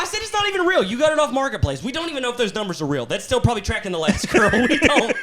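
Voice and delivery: high-pitched, protesting disbelief; exasperated rant. Retold or imitated. Husband discrediting wife's secondhand ring, exasperated.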